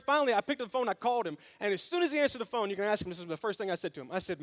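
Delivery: normal talking voice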